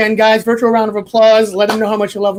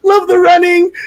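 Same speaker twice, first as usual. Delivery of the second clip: higher, louder, delighted quote; gleeful echo; 8 semitones higher, 4 dB louder than usual. Real, not acted. Host gleefully reads a viewer comment aloud. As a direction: read the comment with glee.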